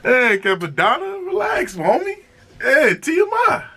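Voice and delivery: high-pitched playful telling-off. Pretend. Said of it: "And it's like".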